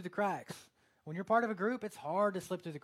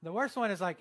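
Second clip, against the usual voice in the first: normal talking voice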